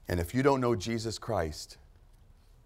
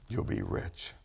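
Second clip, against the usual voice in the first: much lower, almost under the breath; dropped low; 11 semitones lower, slower than usual. Real, not acted. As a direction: let "you'll be rich" sink down low and intimate. Repeated promise, dropped low.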